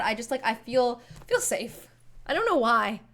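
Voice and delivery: ordinary talk, nothing marked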